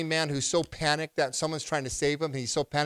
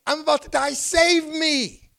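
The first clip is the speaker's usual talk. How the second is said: high, loud desperate plea; a pleading yell for rescue; drowning man's cry; 14 semitones higher, 7 dB louder than usual. Acted. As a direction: cry out like a man going under. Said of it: "This is the drowning man saying".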